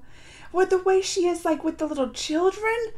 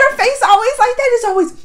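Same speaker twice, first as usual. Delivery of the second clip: laughing; 9 semitones higher, 7 dB louder than usual. Real, not acted. Laughing at sister's face.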